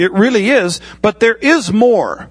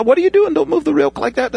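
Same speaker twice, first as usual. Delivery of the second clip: a sharp, much higher startled cry to a student; sudden alarmed trainer; 9 semitones higher than usual. Pretend. Holy Spirit as flight trainer jolting the student pilot: startled, high alarm. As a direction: catch the student's mistake with a jolt, pitch jumping way up. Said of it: "he'll come alongside you and it's like".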